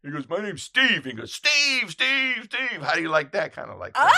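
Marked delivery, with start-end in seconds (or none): 0.3-1.0 loud, high shouted answer; acted shouting match; yelled retort. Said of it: "yelling at him and yelling at him". 1.3-3.5 loud, high repeated name-calling; imitated heckling shout; mocking yell. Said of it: "yelling at him and yelling at him".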